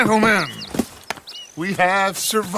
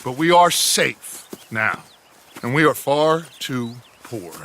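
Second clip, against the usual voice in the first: normal talking voice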